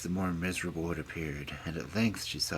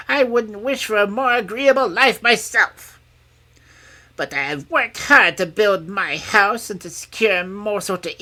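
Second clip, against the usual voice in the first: put-on loud, high, scolding old crone; growling old hag; 14 dB louder than usual. A narrator's reading. Old hag refusing to shelter the princess, growling. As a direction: rant as a grumbling old hag. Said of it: "growled the old hag".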